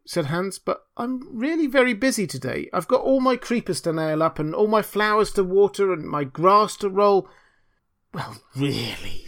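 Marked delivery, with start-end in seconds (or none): 0.6-7.3 higher, anxious simple fellow; flustered little Hans. Acted. Hans excusing himself to the miller, flustered. 8.1-9.2 slow, hushed, drawn-out indignation; affronted miller. Acted. Miller hearing Hans refuse, affronted.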